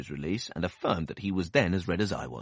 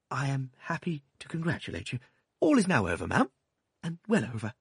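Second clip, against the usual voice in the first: meekest manner; 7 semitones higher than usual. A narrator's reading. Doctor meekly congratulating the stern aunt. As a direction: offer it meekly, hoping to please. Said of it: "meekest manner".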